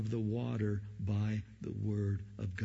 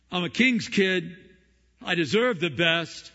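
mock entitled prayer; 5 semitones higher, 8 dB louder than usual.

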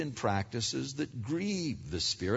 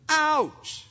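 pained yelp; 12 semitones higher, 4 dB louder than usual. Retold or imitated. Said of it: "the whole body's going".

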